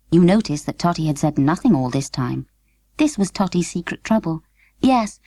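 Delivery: plain reading voice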